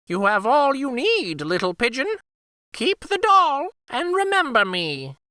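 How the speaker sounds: very high, fond bird voice saying goodbye; the Firebird takes leave, high and light; high Firebird farewell. Acted.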